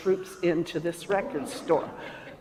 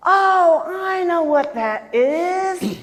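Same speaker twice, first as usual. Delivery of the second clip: high, slow delighted oh I know; high drawn out I know; excited recognition; 10 semitones higher, 6 dB louder, much slower than usual. Retold or imitated. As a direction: clerk beaming he's got it. Record clerk recognizing tune.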